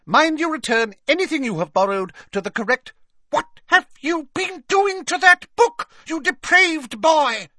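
outraged librarian screeching very high; loud, high, scandalised scolding; Madame Pince. A narrator's reading. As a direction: start brisk, build to loud high-pitched outrage. Librarian outraged at defaced book.

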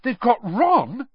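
slow, high, horrified; drawn-out high dismay; gasped shock. A narrator's reading. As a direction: let the horror dawn. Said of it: "Harry gasped".

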